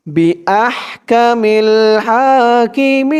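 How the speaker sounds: হাকিমিন chanted high with a very long "meeen"; three-alif stretch. Shown as a demonstration. Teacher showing reading mode, হাকিমিন ending in a long chanted stretch. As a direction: hold the last vowel of হাকিমিন three beats. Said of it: "মধ্যে আরজি তিন আলিফ টান".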